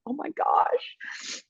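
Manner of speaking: slow, breathless whisper of wonder; awed whisper. Retold or imitated. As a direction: whisper it like a thrilled little girl. Daughter's awe at the ring.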